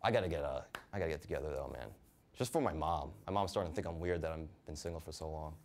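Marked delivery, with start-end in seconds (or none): none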